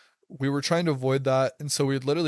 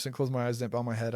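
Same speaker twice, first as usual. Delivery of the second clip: ordinary talk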